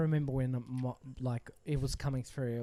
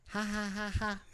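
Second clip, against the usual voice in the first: higher-pitched mock "ha ha" laugh; imitated mocking laughter; 7 semitones higher than usual. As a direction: imitate ladies laughing at someone. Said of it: "laughing at me".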